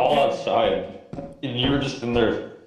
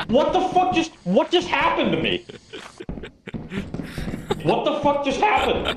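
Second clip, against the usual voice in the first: shocked laughing shout. Real, not acted. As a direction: yell in shocked disbelief. Killed by a ceiling monster.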